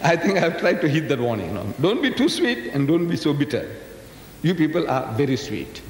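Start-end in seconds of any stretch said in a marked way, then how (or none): none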